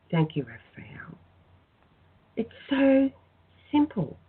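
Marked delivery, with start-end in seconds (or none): 0.1-1.2 soft whispered thank-you, slow. Acted. Healer ending her prayer to Raphael, whispering thanks. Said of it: "closing the prayer".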